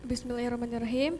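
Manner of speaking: ordinary delivery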